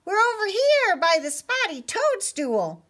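high, loud mouse call; squeaky storybook shout; crying out. Acted. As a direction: shout your hiding place to a rescuer. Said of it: "they all cried".

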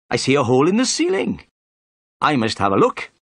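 high inspector voice, dismissive then curious; high-pitched character voice turning puzzled; pompous inspector, distracted. A narrator's reading. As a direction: brush it off, then notice something.

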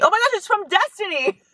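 high, thrilled gasp of recognition; light up like you just saw a friend's name; delighted surprise. Real, not acted. Vlogger finding a gift from her friend Des, high surprised delight.

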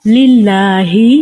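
"লিল্লাহি" drawn out as "lillaaa-hi"; light lam. Shown as a demonstration. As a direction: say "লিল্লাহি" slowly with a thin L and open a. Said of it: "পাতলা করে উচ্চারণ হবে".

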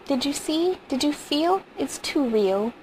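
a touch of sobbing urgency in each question; crying. A narrator's reading. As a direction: plead upward as if close to tears. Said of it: "cried steadily".

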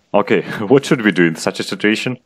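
ordinary talk

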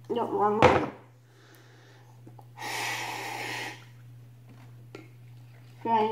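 speaking inside a respirator; muffled through gas mask. Real, not acted. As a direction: voice behind rubber mask. Seen 3: Speaker wears full gas mask on face throughout labelled words.

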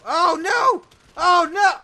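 high loud yell of dismay; real frustrated shout. Real, not acted. Gamer yelling as he dies to an enemy. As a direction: scream it out as you get killed. Sudden death in game.

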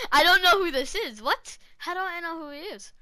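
high, loud burst of confusion; baffled outburst. Real, not acted. Gamer finding an unknown player, baffled outburst. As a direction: blurt it out, totally baffled.

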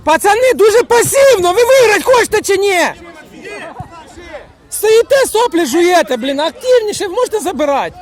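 high, loud scolding across the pitch; shouting. Real, not acted. Scolding the lads for passive play, shouting. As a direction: berate the team at full voice. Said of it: "ну хватить кричати".

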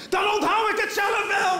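high, loud, drawn-out yell; worked-up, high-pitched comic shout; exasperated shouting. Retold or imitated. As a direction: yell in hammed-up frustration at a stubborn object. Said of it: "tegen dat ding te schreeuwen".

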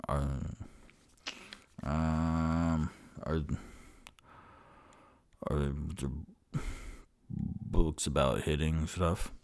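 low, halting character voice; hesitant Onyx. Acted. Customer asks about books on hitting stuff, low and halting. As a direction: ask deep and soft, with long pauses.

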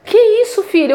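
a touch of awed surprise, drawn out; amazed mother. Retold or imitated. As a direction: mildly imitate mom's wonder at the wet, cold leaf. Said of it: "Minha mãe ficou maravilhada".

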